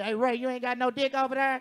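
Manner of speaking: loud mock call to a friend; hollering to Ray for help; hammed-up loud plea; mock panicked call.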